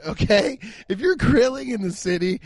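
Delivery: ordinary talk, nothing marked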